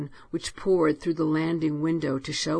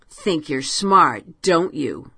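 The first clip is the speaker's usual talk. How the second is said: taunting; 7 dB louder, slower than usual. Acted. Taunting the attic creatures.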